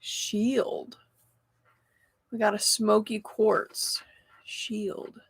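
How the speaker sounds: very soft, half to oneself; hushed musing. Real, not acted. Reader names the oracle card drawn, hushed musing. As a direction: murmur it quietly while reading. Softly murmuring while reading a card.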